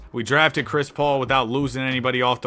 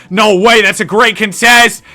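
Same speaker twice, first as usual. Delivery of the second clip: excited; 6 semitones higher, 10 dB louder than usual. Real, not acted. Clutch defensive play.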